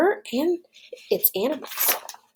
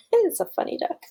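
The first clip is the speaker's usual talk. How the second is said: plain reading voice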